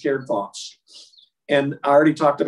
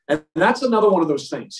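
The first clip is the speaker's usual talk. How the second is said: ordinary delivery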